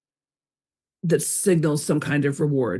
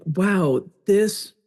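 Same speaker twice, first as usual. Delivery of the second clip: savoring; much slower than usual. Retold or imitated.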